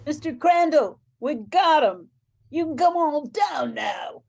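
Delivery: a sergeant shouting up to the father; calling out. Acted. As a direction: call out to the house that the crook is caught.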